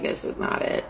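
breathy, disappointed whisper under the breath; whispering to yourself that it's wrong; whispered letdown. Retold or imitated. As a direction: barely voice it, deflated. Disappointed inner reaction.

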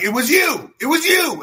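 high, insistent acted accusation; worked-up witness accusing to the face; frantic accusation.

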